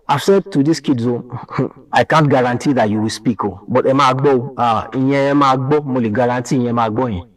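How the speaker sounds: usual speaking voice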